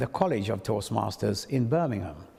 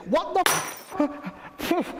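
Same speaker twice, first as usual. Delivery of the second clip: high, fast, shocked blurt; startled by dancers bursting out; startled outburst; much slower than usual. Retold or imitated. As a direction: jump with fright and swear. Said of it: "they frightened me".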